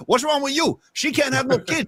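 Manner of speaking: lightly voiced scornful Scarface; Tony Montana. Host retells Tony snapping at his wife, lightly voiced mock anger. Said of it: "He's like".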